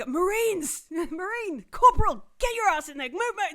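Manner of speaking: loud, high drill-sergeant yell hurrying the Marines; barking staff sergeant. Acted. Staff sergeant herding Marines into the room, yelling loud and high. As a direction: bellow at the troops to get moving. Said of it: "the staff sergeant is like".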